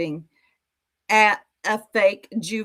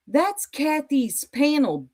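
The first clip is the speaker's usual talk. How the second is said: normal talking voice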